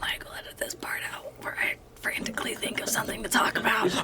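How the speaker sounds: quick, hushed aside to the editor; whispered aside. Real, not acted. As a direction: whisper it fast, off the record.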